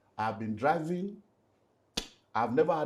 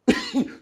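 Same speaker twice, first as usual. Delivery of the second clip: bitter high laugh; 7 dB louder than usual. Real, not acted. Bitter laugh after mental health.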